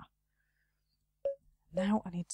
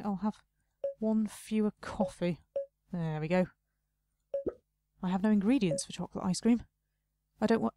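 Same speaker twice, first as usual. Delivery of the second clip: ordinary delivery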